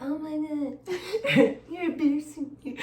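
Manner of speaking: put-on high, slow whiny teen voice; mock embarrassed child, laughing; teen kids embarrassed. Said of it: "they used to be like".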